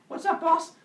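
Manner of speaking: high, loud eager messenger; eager servant. Acted. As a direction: chirp it like a keen helper. Hermes eager reply.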